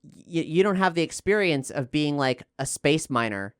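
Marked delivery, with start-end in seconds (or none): none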